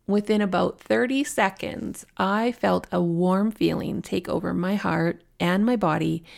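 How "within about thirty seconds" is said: ordinary talk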